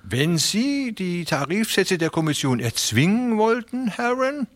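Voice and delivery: high, friendly, persuasive voice; smooth fat railway man. A narrator's reading. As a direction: talk them round with oily politeness. Beermann voice again.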